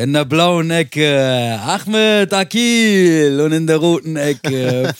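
slow, drawn-out ring announcer hype; Bruce Buffer. Acted. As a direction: stretch every word like a fight announcer. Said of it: "Bruce Buffer".

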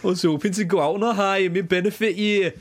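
high-pitched, really bad Geordie; attempted Geordie accent. Host reading cricketer's quote while co-host mocks him, bad Geordie accent. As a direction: strain upward into a terrible Geordie accent. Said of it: "Looking for a kind of Geordie".

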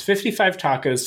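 normal talking voice

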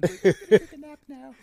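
high, loud, very slow lullaby coo from a fluffy demon; mock gentle demon. Teasing a cohost as a fluffy demon, slow high sweet coo. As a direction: coo it like a tea-sipping demon soothing a victim. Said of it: "the great demon sipping tea".

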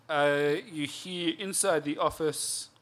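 ordinary talk, nothing marked

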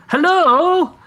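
high, loud shouted greeting; shouting hello. Acted. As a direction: call out into the cavern. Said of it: "I shout out towards the thing".

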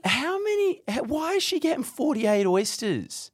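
high, incredulous squeal; you cannot believe the number; shocked high-pitched how many; disbelief. Real, not acted. Shocked at oyster count.

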